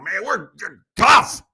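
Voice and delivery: mock tough-guy boast, slow and loud; tough Italians. Imitating Chicago's tough Italians bragging, tough-guy boast. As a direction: puff it up, punching the last word. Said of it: "all the tough Italians that I've known".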